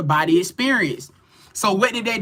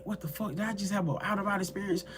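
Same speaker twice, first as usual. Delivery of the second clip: hushed, hurried inner voice; thinking to oneself; 11 dB quieter, faster than usual. Retold or imitated. Wondering alone about an out-of-body experience, hushed thought. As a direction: drop very soft, as private disbelief. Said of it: "thinking to myself".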